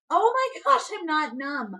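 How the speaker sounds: high, loud panicked protest; retold panic. Mother told to push before she is numb, acted panic.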